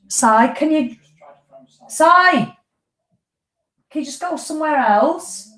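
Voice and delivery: sudden high call away from the mic. Real, not acted. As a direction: call across the house to someone. Calling to someone in next room.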